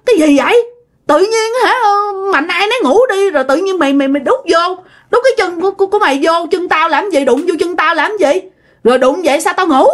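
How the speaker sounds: high, rapid-fire exasperated complaint; very cranky. Retold or imitated. Wife refusing to touch feet at night, cranky. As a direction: imitate someone fed up and grumbling. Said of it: "Lúc đó bà rất là quạo luôn".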